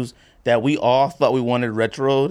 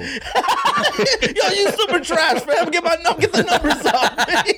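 laughing; 14 semitones higher, slower than usual. Real, not acted. Teasing friend, laughing.

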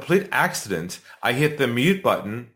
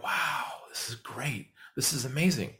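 murmured off in the background, slowed down; overheard through the phone; 11 dB quieter than usual. Retold or imitated. Overheard prospects on muted call.

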